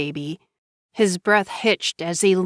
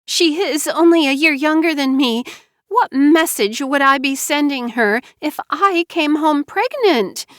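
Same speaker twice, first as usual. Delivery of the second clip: self-pitying Mara Joy; 8 semitones higher than usual. Acted. Wife explaining her pregnancy, high teary plea. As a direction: act wounded and scared.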